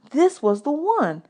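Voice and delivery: ordinary delivery, nothing marked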